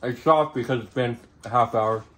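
speaking between bites; talking while chewing. Real, not acted. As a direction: mouth full of food. Seen 2: food visible in mouth, just bit pizza at -3 to -1.

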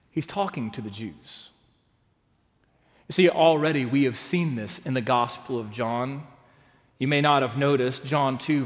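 ordinary talk, nothing marked

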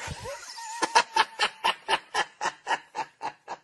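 long, high cackling laugh; real wheezy fit of laughing; real laughter. Real, not acted. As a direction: burst into helpless high-pitched giggles and let it run out. Laughs at hooker headline.

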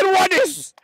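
high, strained mock protest. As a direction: ham up the rival fan. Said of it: "I said".

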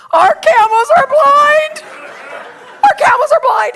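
high, loud, stretched-out cry; imitated panicked wail. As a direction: wail the bad news in comic despair. [name] wails the news.

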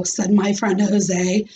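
ordinary delivery, nothing marked

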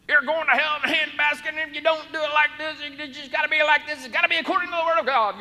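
high, loud, fast hardline preacher mimic; preachy legalist voice. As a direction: rattle off the rules like a scolding zealot. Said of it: "with a certain voice".

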